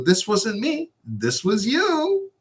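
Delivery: high, drawn-out teasing. Retold or imitated. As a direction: rub it in, playfully. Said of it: "I go".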